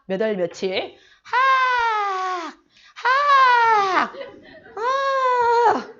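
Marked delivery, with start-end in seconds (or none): 1.2-5.9 기합 소리. Retold or imitated. 도술 장면의 기합 소리.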